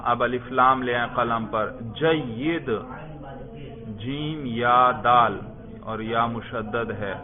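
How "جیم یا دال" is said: slow, separate letter names; name each letter slowly for writing; measured classroom spelling. Shown as a demonstration. Teacher spells the word for writing.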